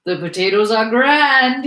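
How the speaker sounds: loud, high, drawn-out relish; big hearty exclamation stretching "grand"; exclaimed praise. A narrator's reading. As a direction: sing the food's praises at full voice. Soldier repeats his praise with gusto.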